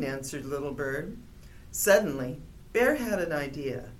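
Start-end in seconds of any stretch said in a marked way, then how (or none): none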